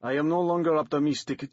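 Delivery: heavy Russian-style accent, lightly voiced; doctor with a thick Russian-type accent; gloomy doctor in a thick foreign accent. A narrator's reading. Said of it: "in a thick accent that sounded like Akim Tamirov".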